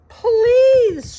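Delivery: high, loud desperate plea; desperate shout. A narrator's reading. Mouse shouts a desperate plea to the rat. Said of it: "shouted Despereaux".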